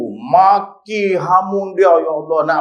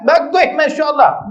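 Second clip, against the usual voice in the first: exclaiming praise; 12 semitones higher, 3 dB louder than usual. Acted.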